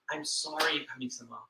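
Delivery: soft, low prayer of apology, dying away; hushed apology. Retold or imitated. Minister apologizing to Kami-sama at the altar, hushed. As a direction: lightly act a humble, quiet confession.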